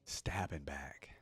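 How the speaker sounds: amused whispered echo. Real, not acted. Fan repeating the yordle's line to himself, whispered. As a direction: breathe it out, savoring the phrase.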